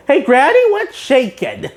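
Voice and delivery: high, chirpy cartoon hello; cheeky SpongeBob greeting. SpongeBob cheekily greeting a granny, high and chirpy. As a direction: greet her brightly up high.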